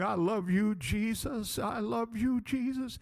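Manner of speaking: soft, rising mock-tearful plea; hushed, high, tearful adoration, acted; imitating tearful crying. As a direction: imitate a child crying out in worship. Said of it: "And he is crying".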